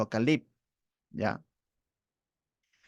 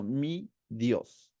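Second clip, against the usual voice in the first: softer, low, deliberate and owning; intimate; 3 semitones lower, 5 dB quieter than usual. Retold or imitated. Caleb doing the opposite of the complainers, quietly intimate. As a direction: say it quietly as personal trust. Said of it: "Caleb está haciendo todo lo contrario".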